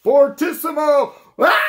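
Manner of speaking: high, very loud shout; fortissimo scream. Shown as a demonstration. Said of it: "It's like a scream".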